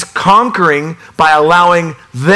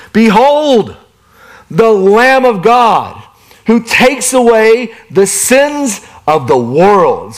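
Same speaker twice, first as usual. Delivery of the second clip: proclaiming; 6 semitones higher, slower than usual. Retold or imitated. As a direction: cry it out like John the Baptist announcing. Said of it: "he proclaimed".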